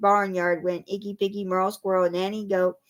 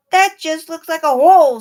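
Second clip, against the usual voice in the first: higher, louder wary bleat of doubt; doubtful sheep; 7 semitones higher, 11 dB louder than usual. A narrator's reading. Sheep eyeing the dark hole, higher and louder. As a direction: sound unsure of the hole.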